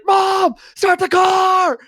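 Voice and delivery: high panicked yell, slow and stretched. Retold or imitated. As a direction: shriek it like a terrified kid. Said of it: "and he's like".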